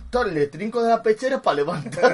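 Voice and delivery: ordinary talk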